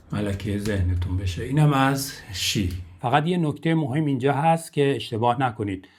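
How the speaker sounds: ordinary talk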